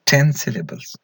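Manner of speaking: ordinary talk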